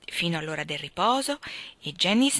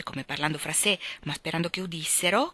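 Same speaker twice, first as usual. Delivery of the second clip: usual speaking voice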